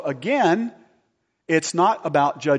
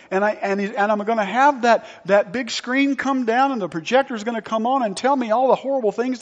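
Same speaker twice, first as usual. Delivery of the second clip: high, incredulous mock alarm; hammed-up dismay, pitched well above normal talk; mock panic; 7 semitones higher than usual. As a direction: play the horrified believer dreading judgment. Mock panic of mistaken believer.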